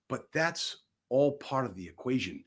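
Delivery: ordinary delivery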